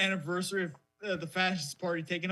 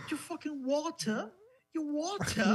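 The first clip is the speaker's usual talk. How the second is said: mock British accent; 8 semitones higher than usual. Mocking British pronunciation.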